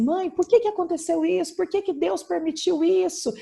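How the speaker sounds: mother mimics her distressed daughter's pleading; imitating upset daughter. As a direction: voice the grieving daughter asking why. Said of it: "então ela falava assim".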